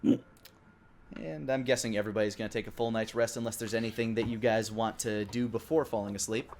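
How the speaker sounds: ordinary delivery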